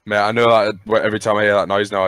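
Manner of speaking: ordinary talk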